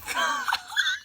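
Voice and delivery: very high shrieking laugh; overwhelmed fangirl shriek; screaming laugh. Real, not acted. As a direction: let out a squealing scream-laugh. Everyone screaming at the gates.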